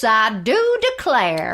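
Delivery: slow, drawn-out Southern gentleman; a hint of Southern drawl; Southern accent. [name] playing a Southern gentleman, slow Southern accent. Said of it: "he starts a talking like this".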